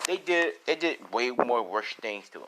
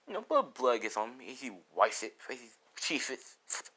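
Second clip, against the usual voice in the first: usual speaking voice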